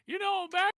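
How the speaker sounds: character voice. Acted. Jack tells grandma story in character.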